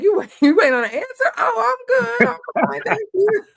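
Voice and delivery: high, laughing, caught-off-guard reply; laughing surprise. Retold or imitated. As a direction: answer high with a laugh, surprised they wait. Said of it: "I'm like".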